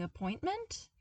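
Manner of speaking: rising, unsure of the word; sleepy questioning appointment; puzzled question. Acted. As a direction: test the word like a half-memory. Luke half-remembering.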